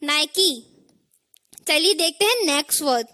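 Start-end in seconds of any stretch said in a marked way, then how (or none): 0.0-0.5 नाइकी in two syllables, "nai-kee"; correct pronunciation. Shown as a demonstration. Teacher asking what we'll say, नाइकी as "nai-kee". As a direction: say नाइकी with a full final ee. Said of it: "क्या कहेंगे इसे?".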